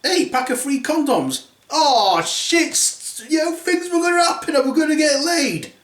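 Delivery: high delighted exclaiming; excited prince. Acted. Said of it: "And then he looks and notices".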